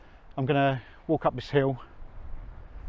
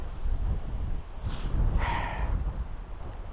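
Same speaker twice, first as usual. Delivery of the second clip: weary sigh. Real, not acted. Gives up on the walk.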